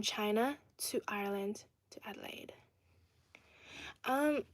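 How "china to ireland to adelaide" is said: whispered quote. Retold or imitated. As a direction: finish the question in a whisper. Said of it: "Ben Churchill says".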